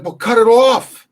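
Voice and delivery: high, loud and slow plea; hammed-up panicked patient; desperate demand. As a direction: belt it out, pleading.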